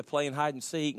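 usual speaking voice

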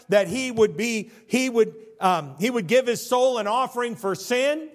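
loud, high, driving pulpit voice; preacher thundering scripture at his flock, loud and high; full-force preaching. Acted. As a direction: preach it hard to the congregation. Preacher pressing his point.

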